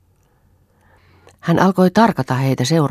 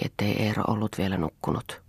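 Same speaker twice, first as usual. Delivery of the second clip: usual speaking voice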